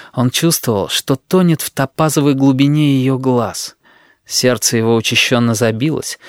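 ordinary delivery, nothing marked

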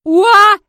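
very loud, high dragon war whoop; battle cry. A narrator's reading. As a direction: let out a piercing charge cry. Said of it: "издал боевой клич". (громкий боевой клич дракона)

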